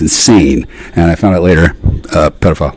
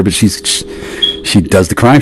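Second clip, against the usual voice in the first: normal talking voice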